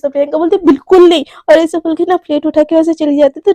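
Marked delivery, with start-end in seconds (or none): 0.7-1.2 firm refusal. Acted. Flat refusal to friend.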